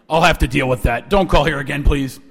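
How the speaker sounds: low, loud, stern dismissal; John Deutsch. Retold or imitated. Director ending the call with student, stern. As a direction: shut the caller down low and firm. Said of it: "And he hung up".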